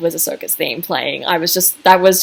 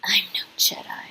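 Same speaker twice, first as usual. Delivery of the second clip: whispered; 9 dB quieter than usual. Retold or imitated. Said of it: "and she just goes".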